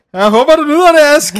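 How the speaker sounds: high and loud mock-grand dedication; hammed-up excitement. Dedicating the episode to co-host Ask, high and loud. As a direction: gush it out to your co-host.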